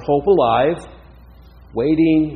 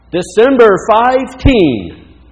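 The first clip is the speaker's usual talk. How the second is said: put-on loud, high battle cry in a German accent; heavy German accent; 8 semitones higher, 9 dB louder than usual. A narrator's reading. Mother Maria rallying everyone for her deadline, heavy German accent. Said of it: "rang forth in her heavy German accent".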